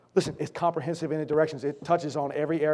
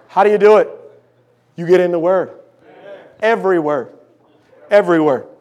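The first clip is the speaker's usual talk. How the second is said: normal talking voice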